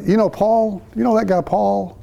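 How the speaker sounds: friendly familiar. Shown as a demonstration. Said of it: "It's not a".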